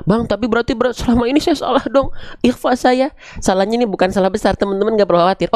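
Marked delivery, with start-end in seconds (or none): 0.1-3.1 much higher-pitched student protest; anxious student voice, raised pitch; worried student. Acted. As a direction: put on a high, concerned pupil questioning the teacher.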